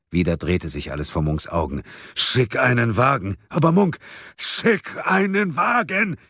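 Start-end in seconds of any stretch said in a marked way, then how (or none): 2.1-3.4 raised-pitch, insistent command; urgent order. A narrator's reading. First, less forceful urgent order. 3.5-4.0 high, loud protest; agitated objection. A narrator's reading. 4.4-6.2 very high, drawn-out yell; bellowed order. A narrator's reading. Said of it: "brüllte Munk".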